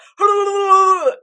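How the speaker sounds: loud, high, long imitated wail; imitated creature noise. Imitating the Chimera noise they had to hear, loud wail. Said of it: "noise again".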